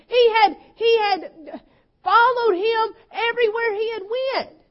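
loud, driving preacher's cadence; preaching at full force. Acted. Pastor building the story of Peter, full preaching voice. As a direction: lift the congregation with each line.

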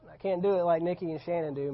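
normal talking voice

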